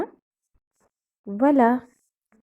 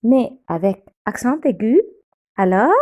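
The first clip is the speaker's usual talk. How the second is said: ordinary talk, nothing marked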